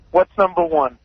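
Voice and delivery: ordinary talk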